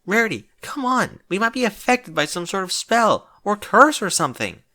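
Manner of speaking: high, insistent and pressing; demanding. Acted. Twilight pressing Rarity to test the spell, insistent. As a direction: push her hard to try it. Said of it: "Twilight demanded".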